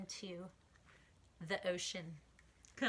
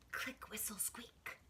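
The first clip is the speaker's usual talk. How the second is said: whisper; 11 dB quieter, slower than usual. A narrator's reading.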